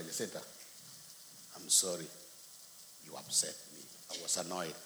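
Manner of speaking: low, soft confession; subdued, halting apology; retold apology. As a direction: own up quietly to his wife. Retells his apology to wife.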